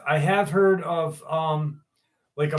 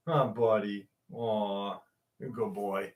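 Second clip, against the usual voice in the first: soft pet talk; 7 semitones lower, 8 dB quieter than usual. Real, not acted. Owner cooing to his cat.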